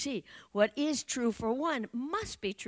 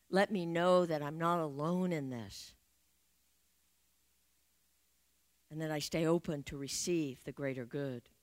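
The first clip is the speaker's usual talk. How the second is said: closing prayer; 4 semitones lower than usual. Acted.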